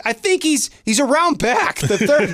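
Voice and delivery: high, eager mock helpfulness; helpful. [name] sends the husband to Devin, high mock eager. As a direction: point him on gladly. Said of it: "I'm like".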